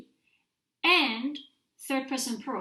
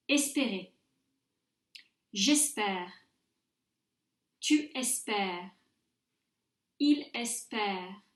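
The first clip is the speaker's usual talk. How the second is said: measured French drill with long pauses; repeat-after-me model; 2 semitones lower than usual. Shown as a demonstration. Teacher models conjugation for students to repeat, careful and spaced out. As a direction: say each form carefully, leaving room to echo it. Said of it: "you may repeat after me".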